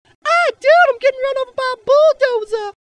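very high panicked mock squeal; squealing victim. Imagined alien being run over, very high mock panic. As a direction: shriek like a cartoon victim under a bulldozer. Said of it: "they'll be like".

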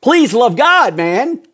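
preacher pleading loudly with the flock to love God; crying out. Real, not acted. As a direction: cry out to the congregation in earnest. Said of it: "I am crying out saying".

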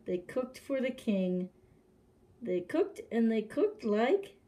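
usual speaking voice

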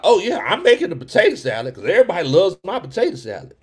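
high, loud smug imitation of the raisin person; mocking voice. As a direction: brag it like the proud potato salad cook. Mocking the raisin potato salad person.

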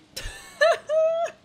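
high burst of laughter; loud amused laugh. Real, not acted. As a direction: laugh out at the bug. Laughing at a glitch.

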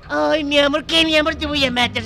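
high, heated mocking of an endearment; scornful anger. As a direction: throw the pet name back, then threaten. Same quarrel replayed. (burla airada y amenaza)

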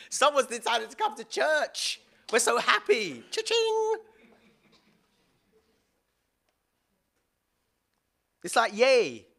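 high, loud, hammed-up cheering; mock excitement. Preacher mocking church glee with a high, loud cheer. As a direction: play the gleeful church crowd cashing in.

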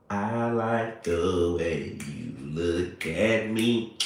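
ordinary talk

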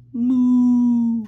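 big loud cow moo. Shown as a demonstration. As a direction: bellow it like a cow. Demoing cow noise.

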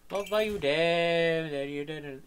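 murmured gloat. Acted. Gamer quietly repeats his taunt to the corpse. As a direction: mutter it to yourself.

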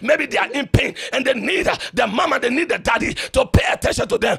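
loud sung-out preacher cadence, voice climbing high; full-voiced revival preaching with a musical lilt; preaching at full force. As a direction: preach it, chanting each phrase to the congregation.

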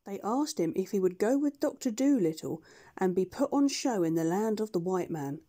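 ordinary delivery, nothing marked

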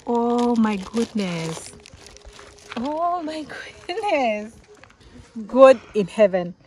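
slow, stunned oh my goodness; drawn-out amazement; awed. Real, not acted. Overwhelmed by the plaque.

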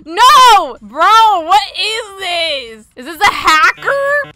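real alarm at a hacker; panicked. Real, not acted. Hacker attacks her game room.

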